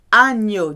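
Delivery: agneau said an-YO, the gn like Spanish ñ. Shown as a demonstration. Teacher demonstrates agneau's ñ sound to the class. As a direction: agneau with a clear ñ sound in the middle. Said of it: "G N is a ñ sound".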